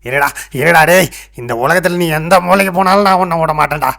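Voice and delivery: loud, high-pitched angry shouting; furious threat. Acted. Sage vowing to hunt down his cheating disciple, furious. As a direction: vow revenge at full voice.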